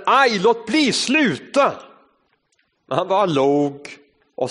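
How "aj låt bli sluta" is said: high, pleading cry of pain; teacup crying out. Acted. Teacup crying out to its master to stop, higher and pleading. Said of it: "Jag ropade".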